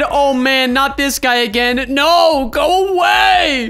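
hammed-up exasperated protest; mock dismay. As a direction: whine building to a high shout. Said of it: "He said".

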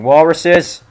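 loud exclamation. Real, not acted. Gamer reacts to enemy.

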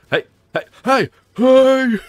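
high hey, building to a loud shout; calling out. Pretend. Player lures an enemy to the corner, calling out.

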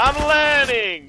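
high, slow, indignant cry; defensive shout. Real, not acted. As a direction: protest loudly, drawn out. Defending his bad smoke.

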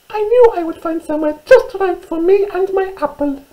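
very high, squeaky mouse voice; little mouse. Acted. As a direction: squeak it out like a tiny storybook mouse. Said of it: "she said".

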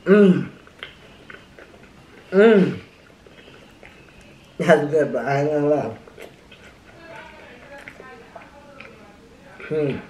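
chewing while talking; speaking between bites; mouth full. Real, not acted. Seen 0: Mouth visibly full, chewing sandwich throughout "Mmm… that's good.

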